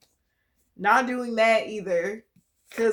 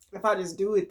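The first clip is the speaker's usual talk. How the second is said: normal talking voice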